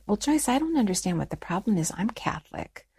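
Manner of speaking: imitating an unruffled grandmother, softly; calm quoted reply, dropping to near whisper; real calm. Grandmother answering her upset daughter, real calm and soft. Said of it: "looked at her real calmly and said".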